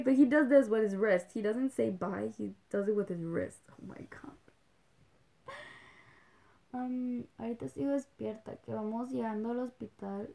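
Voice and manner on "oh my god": soft breathy whisper of awe; much softer whispered awe. Real, not acted. Whispered gush.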